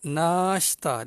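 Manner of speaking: "nashta" with a slow, long "naa"; long vowel. Shown as a demonstration. Teacher recalls the light-meal word, stretched "naa" in "nashta". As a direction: draw out the first vowel of "nashta". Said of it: "dia panjang sikit".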